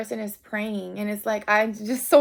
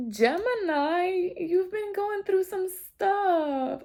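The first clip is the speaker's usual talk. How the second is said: high, slow and tearful, voice cracking; fighting back real tears; choked up with emotion, almost crying; tearing up; 9 semitones higher, slower than usual. Real, not acted. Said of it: "I wanna cry".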